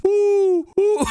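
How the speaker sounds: high, hollow owl hoot. Shown as a demonstration. Gamer shows viewers an owl impression, high hooting. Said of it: "Do you wanna hear my owl voice?".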